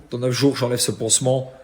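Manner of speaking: normal talking voice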